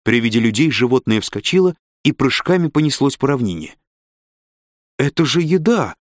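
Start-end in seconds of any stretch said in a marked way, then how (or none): 5.0-6.0 higher-pitched exclamation of realization; suddenly remembering, pitch lifted. A narrator's reading. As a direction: blurt it out as it suddenly dawns. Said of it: "внезапно опомнилась Корделия".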